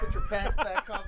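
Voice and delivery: high voice through laughter; laughing while voicing the car; laughing mock voice. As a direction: giggle it out in a silly voice.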